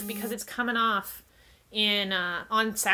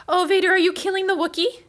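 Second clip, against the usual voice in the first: high, loud playful egging-on; excited; 7 semitones higher, 5 dB louder than usual. Real, not acted. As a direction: cheer the cat on. Cats fighting, cheering on.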